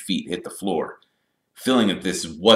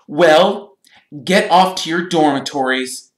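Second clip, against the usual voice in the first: high, loud officious order; stern; 11 semitones higher, 9 dB louder than usual. A narrator's reading. Percy ordering the boys to bed, stern. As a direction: send them off loudly with authority. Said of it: "said Percy sternly".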